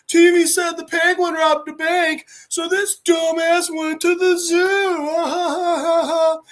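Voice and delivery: put-on high sing-song child taunt; mock like a kid, high and dragging out the end; high-pitched jeering kid voice; roasting kid. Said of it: "And then another one's like".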